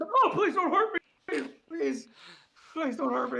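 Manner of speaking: high, whimpering mock plea; pleading crab. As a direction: beg for mercy, half laughing. Crab begs after intimidation.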